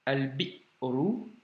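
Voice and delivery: slow al-bi'ru, cut short after "bi"; drawn-out demo of "Al-bi'ru" with a catch after "bi"; hamza blocked. Shown as a demonstration. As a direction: say "Al-bi'ru" syllable by syllable, stopping hard on the hamza. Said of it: "c'est un blocage".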